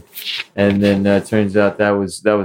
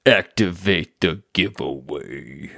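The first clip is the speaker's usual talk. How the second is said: low, slow and soft mock ominous voice; dramatic echo; 3 semitones lower, 5 dB quieter, slower than usual. Co-host echoes the giveaway line low, slow and hushed. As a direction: intone it slowly like a secret command.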